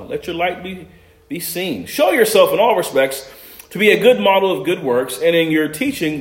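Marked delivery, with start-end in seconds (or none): 1.9-6.2 scripture reading. Acted. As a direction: read the verses aloud with weight.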